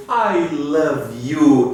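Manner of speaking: I love you, each word slow and clear; slow model reading. Shown as a demonstration. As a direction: say it slowly for students to repeat. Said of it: "a frase I love you".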